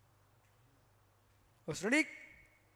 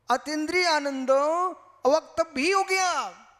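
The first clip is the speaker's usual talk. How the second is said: quoting the other's words; 5 semitones higher, 8 dB louder than usual. Acted. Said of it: "मैं आपके शब्दों का प्रयोग कर रहा हूं".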